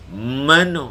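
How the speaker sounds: Kelantan accent Mano with a held, doubled initial m. Shown as a demonstration. Teacher showing Kelantan initial doubling, Kelantan accent. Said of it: "tu tasydid awal".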